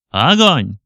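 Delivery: loud fire order; command shout. Acted. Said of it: "скомандовав". (команда «Огонь!» громко)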